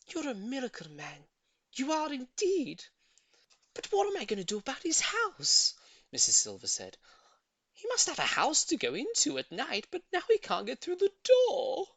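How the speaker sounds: put-on high, fluttery old lady. A narrator's reading. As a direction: gush with admiration, then fret. Voices Missus Silver.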